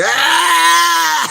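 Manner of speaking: loud, high-pitched mock scream; reenacted scream. Man retelling his run from cave bats, mock screaming. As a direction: scream out long and high, acting out the panic. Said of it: "I start screaming".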